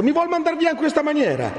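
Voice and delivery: put-on very high, pleading young man; hurt protest. Renzo protests being sent away, pleading.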